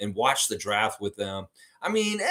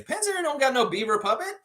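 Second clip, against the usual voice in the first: puppet voice. Pretend. Still in puppet bit.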